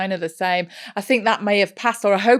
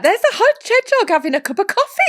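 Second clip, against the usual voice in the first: very high, louder cooing delight; mock gushing mum; 13 semitones higher, 5 dB louder than usual. Friend mimicking the mum cooing over AI animal videos, very high. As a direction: coo over the silly video. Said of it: "You're there like".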